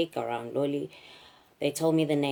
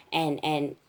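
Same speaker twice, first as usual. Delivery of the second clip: ordinary talk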